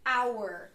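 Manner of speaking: "Hour" said with a silent h, opening straight on the vowel "aw"; vowel-sound demo. Shown as a demonstration. Teacher showing students the silent h in hour. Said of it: "Hour, embora comece com consoante".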